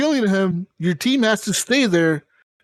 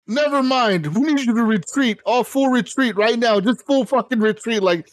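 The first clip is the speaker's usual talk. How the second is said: higher-pitched, mock-urgent commander; urgent retreat order; 5 semitones higher than usual. Commander reversing orders mid-advance, mock urgent. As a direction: imitate an officer hurriedly calling everyone back.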